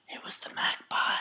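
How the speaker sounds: whispered magpie voice; magpie impression. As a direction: whisper it like a sneaky bird. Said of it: "My impression of the magpie would be".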